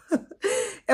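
short high-pitched laugh; amused laugh. Real, not acted. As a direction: break off into a quick, high giggle. Amused by tiny UK flats.

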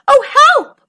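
high, loud wail for help; moaning mackerel. A narrator's reading. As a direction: wail in cartoon distress. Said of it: "moaned the mackerel".

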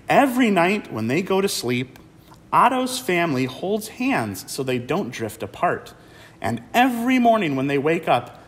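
put-on lively picture-book read-aloud for kids; reading to children. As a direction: read it like storytime.

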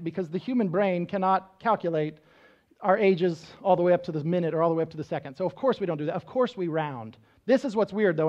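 ordinary talk, nothing marked

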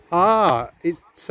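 usual speaking voice

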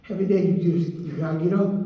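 usual speaking voice

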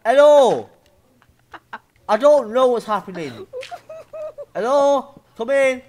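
loud, high hollering; bewildered calling out. Real, not acted. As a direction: holler as if nobody is answering.

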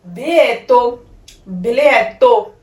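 ਬਿਲੀਏਟੋ said slowly as bee-lee-EH-to, falling at the end; teacher modelling the Italian word; Italian word demo. Shown as a demonstration. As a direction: draw out ਬਿਲੀਏਟੋ syllable by syllable for learners. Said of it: "Italian ਦੇ ਵਿੱਚ ਆਪਾਂ ਕੀ ਕਹਿੰਦੇ ਆ".

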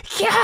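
high, loud scream of terror; woman screaming in a nightmare, high and loud. Retold or imitated. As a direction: shriek in terror as you wake. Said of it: "って叫んだ瞬間".

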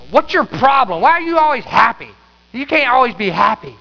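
hammed-up retold yell at the pastor; imitating an older church lady snapping at the pastor in the sanctuary; mock yell. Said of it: "she yelled at me".

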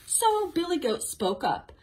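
animated storytime voice with big swings on key words; reading to children. A narrator's reading. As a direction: read a story aloud to small children. Reads picture book to children.

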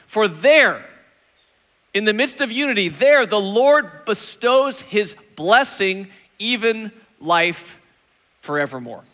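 very slow, drawn-out closing of the verse; slow right down for the final blessing; scripture reading. Acted. Pastor finishing the psalm reading, slow and solemn.

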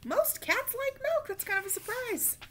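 gentle wonder; mild surprise. A narrator's reading. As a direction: marvel a little. Aside reading commentary.

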